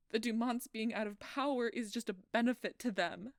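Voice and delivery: loud, anguished, breaking at the top; blaming herself in front of Doc, sobbing loudly; despairing. Acted. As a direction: hurl the hopelessness out. Despairing self-blame, shouting.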